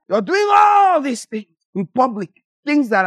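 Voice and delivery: high, loud scolding; preaching shout. Real, not acted. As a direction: call them out publicly.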